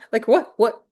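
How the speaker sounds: mock confused what; laughing, imitating a baffled Othello.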